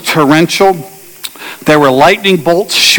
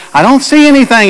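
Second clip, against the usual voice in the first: retold yell; 7 semitones higher than usual. Friend steering blind, calling out, high mock yell. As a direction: call out blind into the rain. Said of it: "she occasionally shouts and yells".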